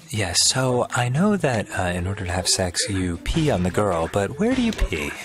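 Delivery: lightly imitated kid, low and halting; hesitant, understated schoolboy question; earnest kid asking. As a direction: ask it like a puzzled classmate. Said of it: "I just remember this kid".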